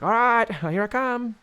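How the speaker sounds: high, drawn-out character call; lightly hammed-up performer voice; costumed entertainer. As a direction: announce the big entrance in a put-on high voice.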